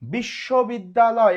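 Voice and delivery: careful slow pronunciation. Shown as a demonstration. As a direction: pronounce বিশ্ববিদ্যালয় slowly and firmly. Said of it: "একটু ধীরে ধীরে উচ্চারণ করেন". (বিশ্ববিদ্যালয় জোরে, ধীরে ধীরে)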